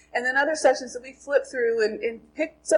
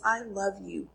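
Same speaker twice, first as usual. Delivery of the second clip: softly voiced words of love; tender; 3 semitones lower, 7 dB quieter, slower than usual. God to the reader in the Bible's love letter, tenderly. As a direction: say it low-key and sincere, as from a love letter.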